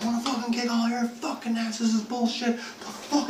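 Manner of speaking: high, strained tirade without full volume; mock furious rant. Freed victim threatening his captors, hysterically angry. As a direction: squeal with re-enacted outrage.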